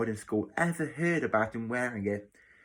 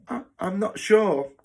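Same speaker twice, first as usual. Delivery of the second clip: higher-pitched, stammered admission; hesitant; 9 semitones higher, 6 dB louder than usual. Acted. New boy answering matron's question about his parents, hesitant. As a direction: stumble uncertainly into the answer. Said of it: "The boy hesitated before answering".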